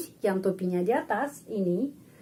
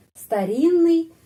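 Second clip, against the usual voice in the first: старинный slow and louder; slow demo; 6 semitones higher, 6 dB louder, slower than usual. Shown as a demonstration. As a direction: say старинный slowly and firmly. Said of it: "seperti satu huruf N". (старинный pelan dan keras)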